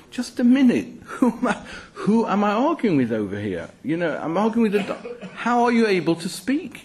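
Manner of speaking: high-pitched mock bafflement, half laughing; incredulous. Speaker voicing Bilam realising his donkey talks, incredulous. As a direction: stop short in amused, high-pitched disbelief.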